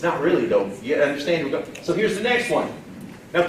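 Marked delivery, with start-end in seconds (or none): none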